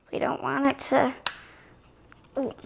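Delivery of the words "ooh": ordinary delivery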